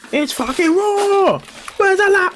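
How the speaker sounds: high, drawn-out mock chef yell; hammed-up kitchen rant, very high; Gordon Ramsay. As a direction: imitate a chef's outraged cry. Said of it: "he did say that once".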